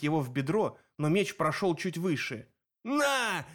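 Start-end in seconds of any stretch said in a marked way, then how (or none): 2.8-3.5 high drawn bleat of a monster; sheep-like bellow. A narrator's reading. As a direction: yell it out like a sheep, pitch way up. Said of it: "заорал Полифем в точности, как его овцы".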